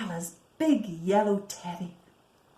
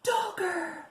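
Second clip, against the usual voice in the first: joyful cry; 9 semitones higher than usual. Acted. Joyful discovery of lost toy.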